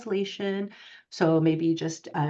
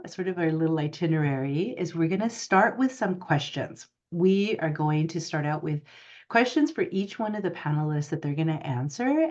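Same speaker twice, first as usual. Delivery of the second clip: ordinary talk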